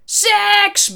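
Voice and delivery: very loud, high roar; one drawn-out shouted word; sudden bellow. A narrator's reading. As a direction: bellow it out of nowhere. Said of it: "brulde Johnny opeens".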